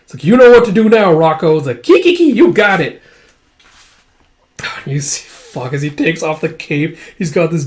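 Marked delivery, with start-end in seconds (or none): 0.2-1.6 loud command. Acted. Command to ally. 1.8-3.0 high, loud cackling gremlin voice; giggling creature. Acted. Rocco giggling as he agrees.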